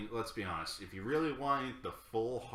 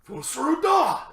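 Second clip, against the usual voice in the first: excited shout; 14 dB louder, much slower than usual. Real, not acted. Excited fan answers who's excited.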